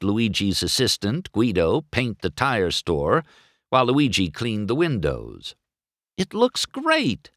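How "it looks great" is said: high, slow, delighted Sally; pleased young woman car. Acted. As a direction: gush happily over the town. Sally thrilled at the town.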